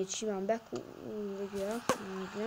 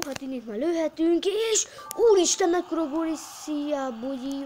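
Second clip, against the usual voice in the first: high, loud goal shout; excited shout; 6 semitones higher, 6 dB louder than usual. Real, not acted. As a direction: yell it like a commentator. Scoring goal in game.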